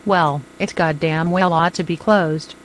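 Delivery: ordinary delivery, nothing marked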